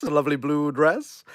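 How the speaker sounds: normal talking voice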